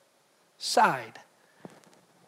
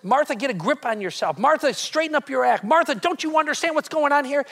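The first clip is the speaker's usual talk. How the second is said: higher and louder, a stern rebuke building up; harsh scolding; 6 semitones higher, 6 dB louder than usual. Retold or imitated. Jesus as he did not speak, scolding Martha in a raised voice. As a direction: snap her name like you're fed up. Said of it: "It wasn't".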